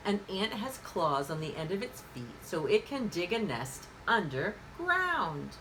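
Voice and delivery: normal talking voice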